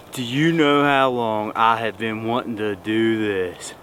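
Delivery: ordinary talk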